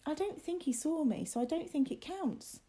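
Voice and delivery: put-on high young boy's voice; William, anxious. A narrator's reading.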